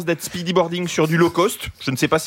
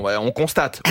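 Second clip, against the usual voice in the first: ordinary talk, nothing marked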